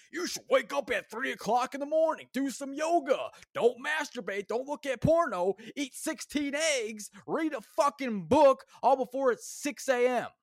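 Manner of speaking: lampoon a smug morning-routine influencer, drawing it out; mocking hustle guru. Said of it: "these people are just so goddamn disconnected".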